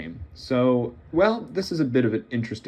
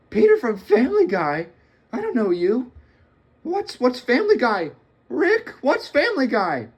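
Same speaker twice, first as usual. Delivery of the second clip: put-on high, nervous teen voice, much higher; confused kid, pitched way up; Morty voice; 11 semitones higher, 4 dB louder than usual.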